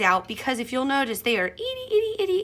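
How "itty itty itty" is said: itty itty sung up much higher; playful high itty, itty, itty; cute itty itty. Real, not acted. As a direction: squeak out itty, itty to show how tiny. Cute emphasis on tiny pieces.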